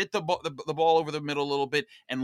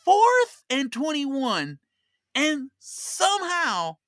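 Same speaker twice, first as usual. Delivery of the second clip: drawn out, high and loud for drama; slowed and raised, savouring the disbelief; slow dramatic emphasis; 10 semitones higher, 6 dB louder, much slower than usual. Acted. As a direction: stretch it like a tense replay. Dramatic game recap.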